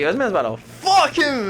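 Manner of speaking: high-pitched retold disbelief; mock shock. Storyteller reacting to the bathroom scene, mock shock.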